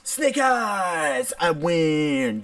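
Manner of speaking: high, drawn-out victory cry; triumphant shout. Real, not acted. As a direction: crow over a winning roll. Celebrating a winning dice roll.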